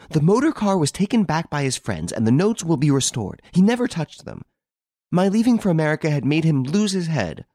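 high, insistent, heated defence; vehement retort. A narrator's reading. Girl excusing her lover's theft to Renine, vehement. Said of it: "She retorted vehemently".

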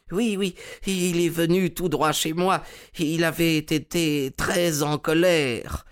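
voix plus aiguë de vieux collectionneur empressé; un collectionneur naïf, un peu plus haut. Acted. (le collectionneur répond à Holmes, voix aiguë et empressée)

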